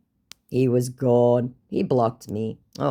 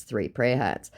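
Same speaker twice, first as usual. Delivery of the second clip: usual speaking voice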